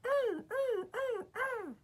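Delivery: very high falling wails of little wagons; crying freight cars. A narrator's reading. Freight cars crying as they bump together. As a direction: wail like jolted toy cars. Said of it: "they cried again".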